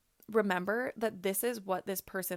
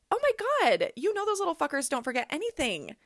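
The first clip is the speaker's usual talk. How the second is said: loud, much higher burst of exasperated glee; excited exclamation; 6 semitones higher, 4 dB louder, faster than usual. Real, not acted. Mother exclaiming about toddlers to listeners, loud high burst.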